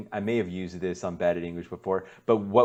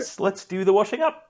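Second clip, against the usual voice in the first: mock-cheery British chore line, pitched up; too cheery; 9 semitones higher than usual. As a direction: sing it out like a chirpy Brit. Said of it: "that just sounds way too cheery".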